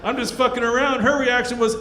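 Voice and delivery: ordinary talk, nothing marked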